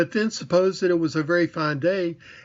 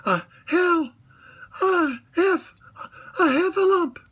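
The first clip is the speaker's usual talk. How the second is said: very high gasp, louder; breathless Piglet; 14 semitones higher, 3 dB louder than usual. A narrator's reading. Piglet, out of breath from running, very high and breathless. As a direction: gasp it out too winded to finish. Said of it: "breathing so hard that he could hardly speak".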